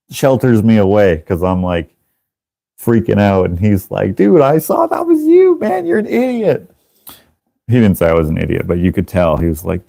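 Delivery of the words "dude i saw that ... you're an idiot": very high mock exasperation; incredulous scolding. Friend who saw the tank driver, scolding incredulously. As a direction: imitate a friend scolding, voice pitched way up.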